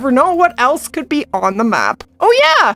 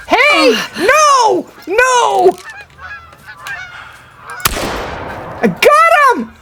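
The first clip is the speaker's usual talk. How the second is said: excited yells; much slower than usual. Real, not acted. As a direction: shout through the shot. Shooting the rare animal live.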